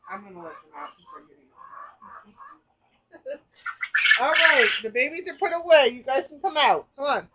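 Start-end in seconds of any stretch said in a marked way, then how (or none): 3.1-4.2 laugh. Real, not acted. Laughs as birds come out. 4.2-7.3 loud, bright call to the birds, higher. Real, not acted. Calling birds out of kennels.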